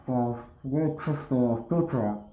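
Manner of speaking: speaking through a gas mask; talking behind a respirator mask; muffled by gas mask. Real, not acted. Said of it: "with this gas mask on".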